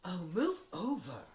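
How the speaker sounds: high, loud, slow impatient snarl. Acted. Hermione pushes the boys aside, impatient snarl. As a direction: shove past them. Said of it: "Hermione snarled".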